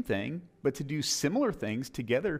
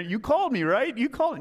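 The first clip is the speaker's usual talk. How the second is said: put-on eager boy; 10 semitones higher, 4 dB louder than usual. Eager boy wakes old Eli.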